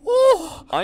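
sudden high scared yelp; startled high shriek of fright. Real, not acted. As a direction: jump at the scare with a squeal. Jump scare in horror game.

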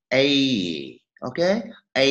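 "A" as E gliding into Y; two-note diphthong. Shown as a demonstration. Teacher shows how the diphthong is read, two-note gliding vowel. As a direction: glide through "A" on two musical notes. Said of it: "Âm dài đọc bằng hai nốt nhạc".

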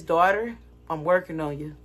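God. Retold or imitated. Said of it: "And then God was like this".

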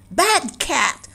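sharp scolding voice. Acted. Master scolding his cat for clawing a guest, sharp scold. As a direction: scold a naughty pet. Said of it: "scolded Lorenzo".

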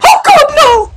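shrieking high, loud no; panicked scream. Real, not acted. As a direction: scream in panic as you die.

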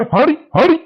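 calling out. Acted. Calling out at the dog.

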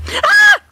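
high startled scream; startled shriek. Real, not acted. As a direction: shriek in a sudden fright. Startled in fight.

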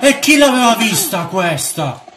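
high, startled exclamation; startled disbelief. Real, not acted. Player caught by an unseen enemy, startled high exclamation. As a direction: cry out at something unseen.

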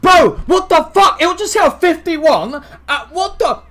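high, loud, stammering hype; excited shouting. Real, not acted. Commentator hyping high-class players as Ilt hits big, shouting. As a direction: lose it completely over the hit. Losing it over huge hit.

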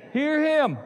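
loud, high, ringing call of the key words; emphatic. Shown as a demonstration. Pastor calling out the key words to the church, loud and high. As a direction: proclaim it to the congregation. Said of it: "Catch the last two words of that".

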